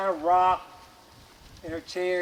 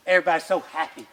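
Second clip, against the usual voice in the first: ordinary delivery, nothing marked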